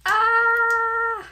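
sudden high loud shriek; startled shriek. Real, not acted. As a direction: yelp as something nearly tears. Startled while opening the package.

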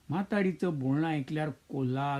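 ordinary delivery

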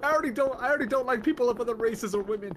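high, hurried mock confession; mimicking pleading friend. Friend mocks streamer as hating animals too, high pleading imitation. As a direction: whine it high as a teasing impersonation. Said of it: "He's like".

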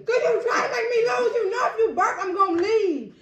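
high laughing protest; laughing shout. Real, not acted. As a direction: yell it while cracking up. Laughing protest about the burp.

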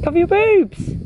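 high shout to mum; calling out. Real, not acted. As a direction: call across the pool.

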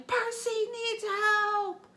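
high, slow drawn-out yell; urgent yell, stretched out. Acted. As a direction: bellow it while charging in.

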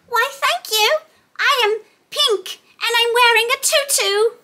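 high cheerful piggy voice with oinks; Peppa Pig voice. Acted. Teacher voicing Peppa toy for toddlers, high and cheerful. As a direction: play the cute pig toy. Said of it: "isn't she?".

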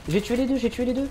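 high, loud cheering; triumphant. Real, not acted. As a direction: shout the win. Triumph after killing two.

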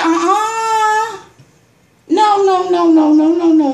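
loud, high no no no; loud refusal. Retold or imitated. Refusing the crew's excuses, loud high no. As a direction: shut them down hard. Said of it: "I said".

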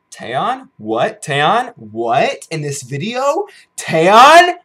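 rising questions building to a loud, high shriek; building fangirl disbelief; incredulous excitement. Real, not acted. Incredulous gushing over a singer.

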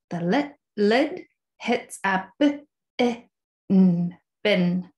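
lid and bin broken into sounds; sounding out words. Shown as a demonstration. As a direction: sound out b-i-n slowly. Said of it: "You're sounding out the words".

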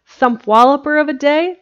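thrilled question rising on day; ecstatic. A narrator's reading. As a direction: bubble over with joy at the new word. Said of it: "Jimsy's reply was ecstatic".

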